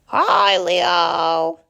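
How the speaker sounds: loud call across the grass; shouted greeting. Acted. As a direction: shout hello up to the lion on his rock. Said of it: "they both shouted".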